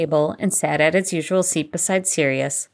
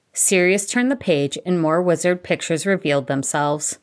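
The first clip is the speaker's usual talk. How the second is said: usual speaking voice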